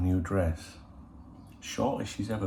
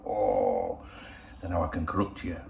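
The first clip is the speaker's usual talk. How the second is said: cheeky; 4 semitones lower than usual. Retold or imitated. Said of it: "I'm a bit cheeky".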